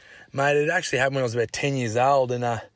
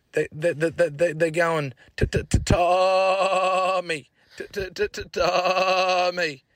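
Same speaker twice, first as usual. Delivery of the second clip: slow stammered mocking, higher; mock stutter; 6 semitones higher, slower than usual. Tommy repeating the teasing on the radio, slow stammer. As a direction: drag out the stammer slowly. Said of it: "had a stutter".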